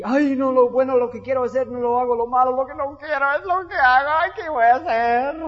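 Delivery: loud, wailing cry of a believer caught in sin; an exaggerated, despairing inner struggle; anguished lament. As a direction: wail it out like someone who keeps failing.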